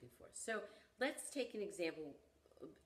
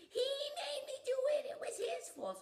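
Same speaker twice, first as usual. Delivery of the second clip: put-on much higher, quick childish protest; a four-year-old pushing the blame away; little girl blaming; 8 dB louder than usual. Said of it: "So she blamed it on her brother and said".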